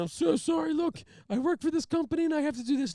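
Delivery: very high, pleading whine; tearful apology. Retold or imitated. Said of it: "start crying again".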